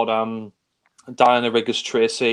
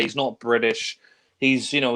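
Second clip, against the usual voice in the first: ordinary talk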